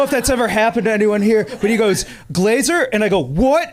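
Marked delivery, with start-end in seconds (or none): 2.3-2.9 high, questioning call of a name; unsure. Retold or imitated. Man hailing someone he thinks he recognizes, unsure. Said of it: "I can hear in his voice that he's not sure". 3.2-3.7 high startled reply. Retold or imitated. Comic reenacting his turn toward a stranger, startled. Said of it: "it startled me, and I turned so fast".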